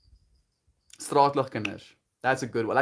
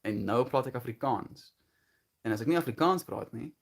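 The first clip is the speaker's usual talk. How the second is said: speaking Afrikaans. Shown as a demonstration. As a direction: switch into native Afrikaans. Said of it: "I just speak English".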